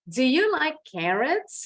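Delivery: slow yes-no question lifting on "carrots"; rising question intonation. Shown as a demonstration. Teacher models a non-WH question, rising intonation. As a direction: let "carrots" swing upward at the end. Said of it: "kalau yang ini intonasinya baru naik".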